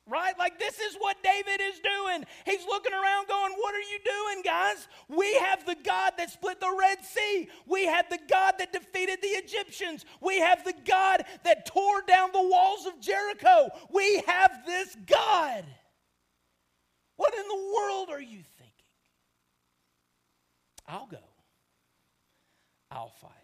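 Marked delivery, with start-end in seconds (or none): 3.5-18.7 shouted high and loud as David rallying the troops. Retold or imitated. Preacher voicing David, shouting to the scared army. 20.9-23.3 suddenly low and soft, quietly determined; quiet resolve. Retold or imitated. David quietly volunteering to fight the giant.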